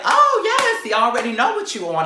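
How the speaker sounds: very high, loud exclamation; mock fired-up comeback. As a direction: shoot way up, then race on. Squaring up to the rival.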